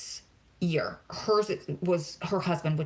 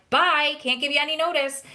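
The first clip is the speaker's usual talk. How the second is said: higher-pitched flippant kiss-off; breezy mock farewell; 7 semitones higher, 4 dB louder than usual. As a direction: toss off a mock goodbye. Breezy mock quitting of job.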